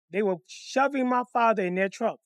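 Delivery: ordinary delivery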